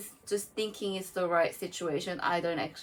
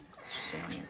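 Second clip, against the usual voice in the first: laughing; 10 dB quieter than usual. Real, not acted. Laughing at her failed accent.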